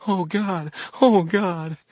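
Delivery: high, breathy, mounting panic; panting. A narrator's reading. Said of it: "said Fix, panting".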